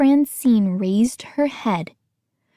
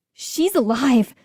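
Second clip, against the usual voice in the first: excited cry; 3 semitones higher than usual. A narrator's reading. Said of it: "Gates cried".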